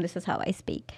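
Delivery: soft unvoiced whisper. Real, not acted. Guest whispers her confession to the host. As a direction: confide it in a hushed whisper.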